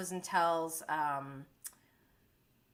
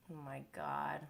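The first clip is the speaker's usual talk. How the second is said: soft, lower, under-the-breath oh my God; quiet stunned mutter; 7 semitones lower, 13 dB quieter than usual. Real, not acted. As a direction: say it quietly to yourself, deflated. Reacting in disbelief to show.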